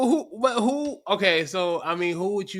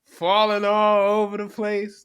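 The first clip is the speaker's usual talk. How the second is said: ordinary delivery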